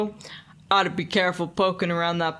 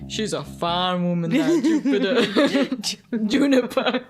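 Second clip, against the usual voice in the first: put-on laughing old man; 4 semitones higher, slower than usual. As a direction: laugh through it fondly.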